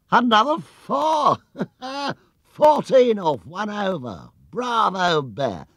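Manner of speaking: high, loud shouting with a laugh; jubilant cheering. A narrator's reading. Spectator cheers the bear's four, loud and laughing. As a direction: roar approval.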